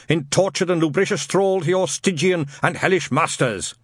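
put-on loud, high, ranting old zealot; fire-and-brimstone rant. Shadwell mishearing Newton's name, ranting loud. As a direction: rant it like a hellfire preacher.

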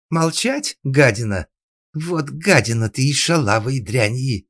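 high, loud string of insults; furious screeching mistress; speechless rage. A narrator's reading. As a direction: sputter insults until words fail. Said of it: "от злости даже слов не хватало".

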